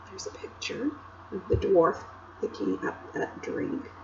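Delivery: whispered aside to the listener. Real, not acted. Reader whispering to the children about the picture. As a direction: hush it like a shared secret. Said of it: "reader whispers an aside".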